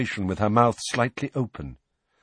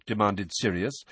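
plain reading voice

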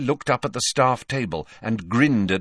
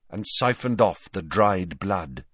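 ordinary delivery, nothing marked

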